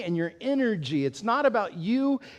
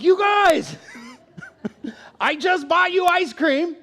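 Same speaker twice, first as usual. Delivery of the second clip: put-on high, loud exasperated yelling at the kids; lost it; 10 semitones higher, 5 dB louder than usual. A mom in the car, yelling at bickering kids. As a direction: blow up at them for being ungrateful. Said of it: "I lost it".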